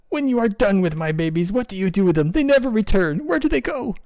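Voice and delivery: distraught penguin queen. Acted. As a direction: demand answers in rising grief. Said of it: "And the queen says".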